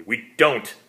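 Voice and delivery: sudden loud outburst; almost angry. A narrator's reading. Vampire bursting out at the dying psychic, almost angry. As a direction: snap the words out in frustration. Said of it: "and then he said almost angrily".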